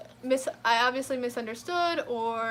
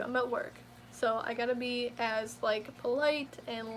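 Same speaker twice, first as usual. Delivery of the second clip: ordinary talk, nothing marked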